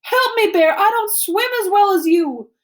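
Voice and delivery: high, loud, panicked plea; desperate cry for help. A narrator's reading. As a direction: shout for rescue from the water. Drowning girl calls for help.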